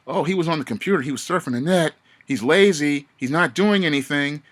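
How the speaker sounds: high-pitched mimicked tattling complaint; mock accusing. Coworkers reporting a man to get him fired, mock accusing.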